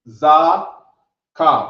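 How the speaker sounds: "Za, ka" loud with a rising question tune. Shown as a demonstration. Teacher showing how to ask "Za ka" as a question. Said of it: "you say it like a question".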